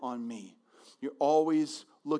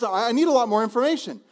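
mock nervous; 8 semitones higher, 8 dB louder than usual. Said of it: "real nervous".

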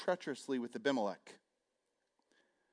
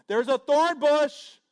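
loud parable voice; 12 semitones higher, 12 dB louder, slower than usual. Acted. Acting out the parable loudly.

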